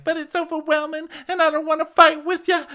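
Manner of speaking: very high squeaky mock whine; Jennifer. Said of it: "And Jen's like".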